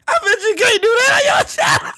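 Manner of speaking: high, squealing laughed-out taunt; taunting through laughter. Real, not acted. Streamer dares rival about his channel, laughing high. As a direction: crack up while daring him.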